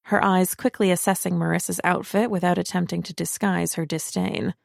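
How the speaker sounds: ordinary delivery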